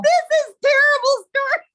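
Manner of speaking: high, loud, half-laughing setup; laughing dread. Real, not acted. Teller groaning to listeners before a bad story, high and loud.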